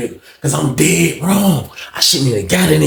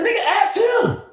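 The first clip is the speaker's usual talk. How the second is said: very high, softer mock shout; retold heated demand; 5 dB quieter, slower than usual. Inmate repeating his challenge to a rival, high mock shout. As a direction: imitate an indignant comeback up high.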